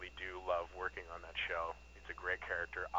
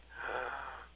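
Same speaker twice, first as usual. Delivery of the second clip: sharp startled intake of breath; a retold gasp of shock, half played; 2 semitones higher, 7 dB quieter than usual. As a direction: gasp as if reading bad news. Reading his character's death.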